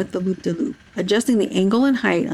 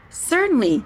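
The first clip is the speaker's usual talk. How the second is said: proud; 6 semitones higher than usual. A narrator's reading. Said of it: "Henry replied proudly".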